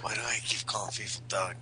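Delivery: hushed whispered aside to himself; whispering. Real, not acted. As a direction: whisper it, half laughing. Self-aside under his breath.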